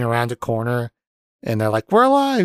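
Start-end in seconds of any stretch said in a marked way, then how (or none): 1.9-2.4 high, loud squeal of joy; mock excited announcement. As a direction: imitate overjoyed survivors bursting in. Acting out a surprise reunion.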